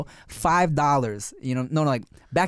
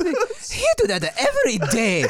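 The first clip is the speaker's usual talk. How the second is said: mock tour guide. Friend joins imitation.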